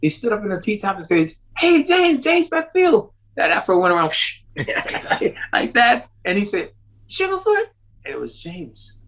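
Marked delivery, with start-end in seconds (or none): 1.6-3.1 much higher and louder hail; calling out. Retold or imitated. Calling out from the car. 7.1-7.6 much higher rising question; surprised recognition. Retold or imitated. James recognising him, surprised.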